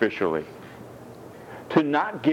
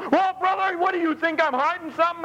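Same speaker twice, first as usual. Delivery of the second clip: mock defensive; faster than usual. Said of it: "defensive reply, quarrel".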